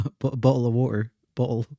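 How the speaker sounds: bottle. said bo-uhl with no T; Bottle. with a glottal stop for the T. Shown as a demonstration. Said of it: "Don't pronounce the T in that one either".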